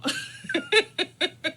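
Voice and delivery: loud high burst of laughter; big laugh. Real, not acted. Laughing at mama moment.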